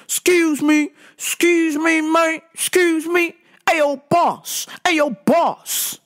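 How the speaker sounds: mock demanding shout for attention; aggressive customer. Weird customer hollering at a store worker, aggressively. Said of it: "the attitude is very aggressive".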